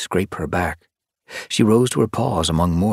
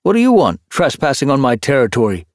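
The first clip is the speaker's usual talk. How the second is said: higher, louder territorial challenge; suspicious tomcat; 7 semitones higher, 6 dB louder than usual. Acted. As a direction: demand it like a wary tom. Hutch challenges a stranger.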